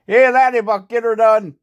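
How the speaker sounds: high, loud mock Irish-Canadian mom; mom's brogue. Vlogger imitating his mom urging him on, hammed-up brogue. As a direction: bark it like a hearty leprechaun mom.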